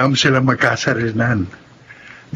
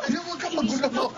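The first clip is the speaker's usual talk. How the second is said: laughing through the words, much softer; 12 semitones higher, 10 dB quieter than usual. Acted. Boyfriend teasing his girlfriend as he carries her, laughing softly. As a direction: giggle it out playfully, quiet.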